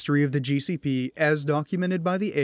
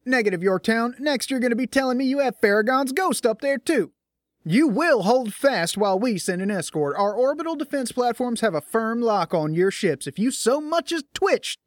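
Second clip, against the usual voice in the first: suspicious officer; 8 semitones higher than usual. A narrator's reading. Suspicious officer threatens ship.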